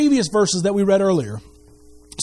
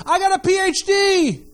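mock bragging; 7 semitones higher than usual. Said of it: "somebody ... go".

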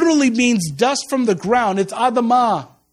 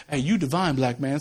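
mock flattery; 8 semitones lower, 6 dB quieter than usual. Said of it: "people telling us".

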